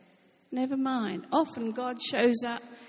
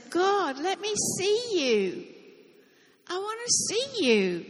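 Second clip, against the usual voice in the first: put-on much higher, slow child's plea; pleading child; 7 semitones higher than usual. A narrator's reading. Preacher voicing the child begging to see God: high pleading. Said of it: "The child looked around and said".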